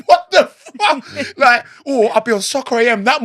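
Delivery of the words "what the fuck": high, slow, laughing disbelief; drawn-out laughing what the fuck; stunned disbelief. Retold or imitated. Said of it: "all the boys would be like".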